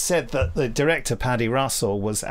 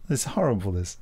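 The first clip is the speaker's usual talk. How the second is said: ordinary talk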